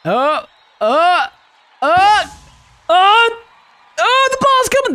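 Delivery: mounting excitement. Real, not acted. Anticipation as ball returns.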